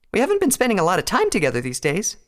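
put-on high-pitched girlish complaint; disappointed Pinkie Pie. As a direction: protest in a high character voice. Pinkie complains to her friends.

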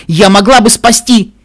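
loud, high, slow plea; desperate plea. A narrator's reading. Narrator longs to warn her, desperate. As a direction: build to an urgent cry.

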